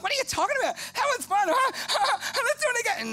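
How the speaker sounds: cartoonish craving brain, laughing; brain's voice. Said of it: "the brain's like".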